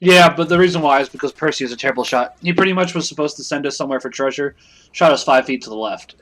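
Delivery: talking while chewing chicken; words round a bite of food; mouth full. Real, not acted. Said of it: "Sorry, I'm still eating chicken".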